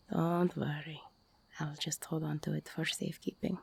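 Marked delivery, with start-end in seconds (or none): 1.6-3.6 whisper. Acted. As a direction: breathe it right into their ear.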